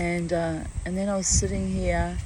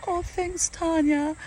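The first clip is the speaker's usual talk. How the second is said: mock sweet Scott; 12 semitones higher, slower than usual. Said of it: "he was like".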